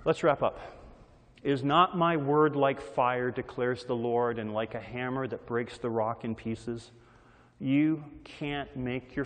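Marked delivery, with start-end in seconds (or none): 1.4-6.9 measured, reverent recitation; scripture reading. Acted. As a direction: read the verse with weight.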